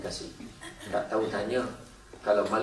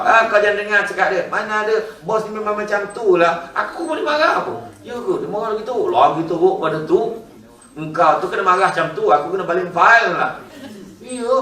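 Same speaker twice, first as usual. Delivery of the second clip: higher, louder excited office gossip voice; high gossipy coworker; 7 semitones higher, 6 dB louder than usual. Acted. Coworker tells friend about the boss, in a high, loud gossipy voice. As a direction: play the coworker who eggs her friend on.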